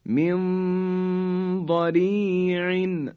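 من ضریع slow, nun hidden into heavy ض; ikhfa before dad. Shown as a demonstration. Teacher reciting slowly to show ikhfa before ض. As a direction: draw out من ضریع. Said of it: "نون ساکن به حرف داد رسید".

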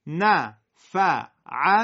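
نفعتی slow and loud, syllables held apart. Shown as a demonstration. Teacher leads the class through نفعتی slowly, syllable by syllable. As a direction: lay out نفعتی beat by beat. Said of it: "با هم بگین".

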